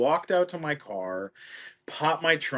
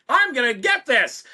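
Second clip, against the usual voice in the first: confident; 13 semitones higher, 7 dB louder than usual. Retold or imitated. Imagined confident self.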